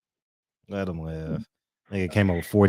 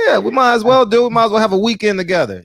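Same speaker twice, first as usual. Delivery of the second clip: 1 excited; 12 semitones higher, 4 dB louder, faster than usual. Real, not acted. Eager agreement.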